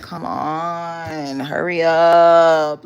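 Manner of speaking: mock impatient whine, drawn out and swelling loud; hammed-up nagging, stretching each phrase; doing the most. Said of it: "he would do the most".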